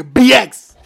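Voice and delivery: B X called out loud and proud; local slang, loud. Shown as a demonstration. Bragging to his friend about saying BX, loud. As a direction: shout it like a Bronx local. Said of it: "I don't say the Bronx".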